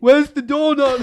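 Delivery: slow, high, loud bewildered question; drunk confused voice. Retold or imitated. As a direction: ask it like a lost drunk. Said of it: "I was like".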